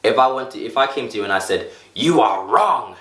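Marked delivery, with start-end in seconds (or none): 1.9-3.0 slow, blunt "You are wrong"; blunt accusation. Shown as a demonstration. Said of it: "wouldn't that feel different".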